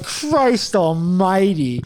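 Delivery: slow, drawn-out retold exasperation; exasperated oath. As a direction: drag out each word in dismay.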